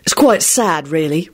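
serious. A narrator's reading. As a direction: say it gravely, dropping at the end. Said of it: "William said seriously".

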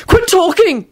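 high loud angry yell; hot yell. A narrator's reading. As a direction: yell it hotly. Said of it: "Neville yelled hotly".

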